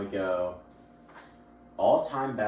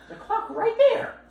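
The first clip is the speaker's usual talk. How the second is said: high-pitched, laughing; laughing squeal; 9 dB louder than usual. Real, not acted. Pointing at the clock, laughing high and loud.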